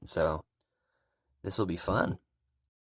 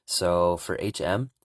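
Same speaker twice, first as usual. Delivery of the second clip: ordinary delivery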